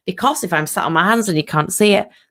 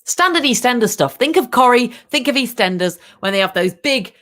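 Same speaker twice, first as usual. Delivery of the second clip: normal talking voice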